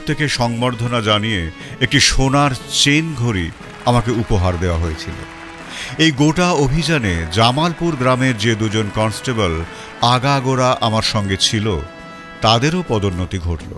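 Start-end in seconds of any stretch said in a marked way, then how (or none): none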